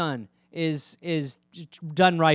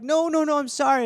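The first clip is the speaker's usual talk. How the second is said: high apologetic coo; apologetic owner; 8 semitones higher, faster than usual. Retold or imitated. Owner apologizing to cat, high coo. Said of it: "You're like".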